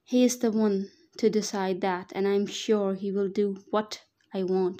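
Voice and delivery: ordinary delivery, nothing marked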